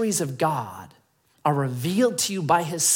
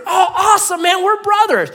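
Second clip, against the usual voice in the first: high, loud, hammed-up delight; overjoyed rescuer; 8 dB louder than usual. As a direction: cheer him like a long-lost brother. Rescuer rejoices in acted exchange.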